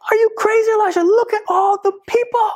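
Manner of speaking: a panicked servant pleading with the prophet; frantic servant. Retold or imitated. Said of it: "the servant's like".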